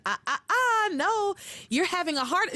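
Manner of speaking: high teasing no-no-no; scolding sing-song. Retold or imitated. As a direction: wag a finger while singing it. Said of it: "And she's like".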